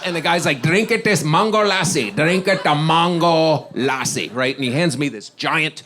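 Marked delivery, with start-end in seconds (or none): 0.6-4.3 put-on loud, insistent Indian-accented waiter, slowing down; imitated Indian accent. Waiter urges drink on burning diner, loud Indian accent.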